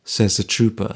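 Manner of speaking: ordinary delivery, nothing marked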